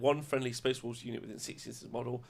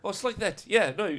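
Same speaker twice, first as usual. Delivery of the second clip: put-on South African accent; 7 semitones higher than usual. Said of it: "in our South African accents".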